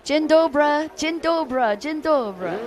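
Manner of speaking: high, loud greeting in clumsy Polish; horrible Polish accent. Real, not acted. Announcer greeting Polish fans in the stands, horrible Polish accent. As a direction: call it out with a bad Polish accent. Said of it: "my horrible Polish accent".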